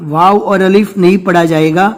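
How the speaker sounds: usual speaking voice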